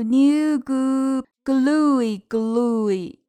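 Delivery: slow, sing-song picture book reading; reading to a toddler. Acted. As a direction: read it out like a bedtime book. Reads the book aloud to kids.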